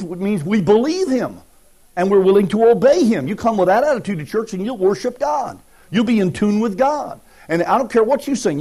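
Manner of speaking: loud, high preaching at full force. Acted. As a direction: preach it out to the congregation.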